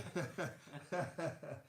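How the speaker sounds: amused run of short chuckles; real chuckle. Real, not acted. Teacher laughing at his partner swimming, real chuckle. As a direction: chuckle freely at the joke. Joking about him swimming.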